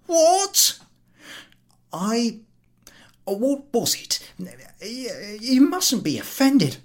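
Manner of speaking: high, loud startled cry; startled invalid. Acted. Invalid woken by the collector, startled. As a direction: jolt awake in alarm. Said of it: "starts and leaps up".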